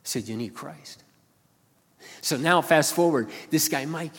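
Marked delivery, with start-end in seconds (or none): 0.0-1.0 soft reply. Retold or imitated. Preacher's gentle reply.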